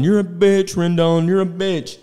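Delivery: high mocking heckle at the player; sing-song taunting fan voice; heckling fan. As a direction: jeer like a random guy in the stands. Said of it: "sitting there going".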